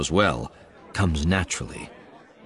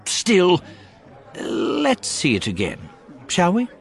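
leering character voice; 11 semitones higher than usual. A narrator's reading. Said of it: "He licks his lips and says".

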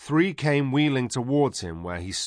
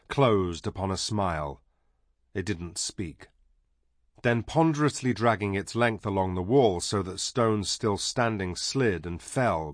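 ordinary delivery, nothing marked

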